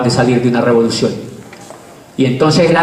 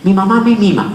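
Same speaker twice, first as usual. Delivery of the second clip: higher, sing-song teaching voice; coaching a toddler; 5 semitones higher than usual. Retold or imitated. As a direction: coax the child sweetly. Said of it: "Yo le digo".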